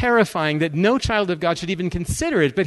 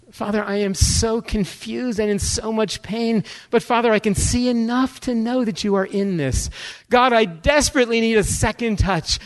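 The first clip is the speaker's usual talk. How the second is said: pleading prayer; 7 semitones higher than usual. Retold or imitated. Praying to the Father for a second touch, desperately pleading. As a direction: pray harder and harder, begging.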